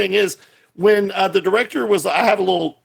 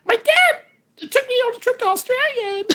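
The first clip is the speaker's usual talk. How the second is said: put-on much higher, pouty little-girl voice; childish girl voice. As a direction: play a pouty teen girl, up high. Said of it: "And she's like".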